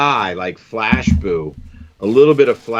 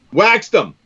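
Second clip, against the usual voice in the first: high, punchy exclamation; excited boast; 10 semitones higher than usual. Real, not acted. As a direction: brag it with a jump up in pitch. Boasting about a big catch.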